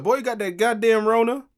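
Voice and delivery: high, loud scolding auntie. Retold or imitated. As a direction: scold like a sassy aunt.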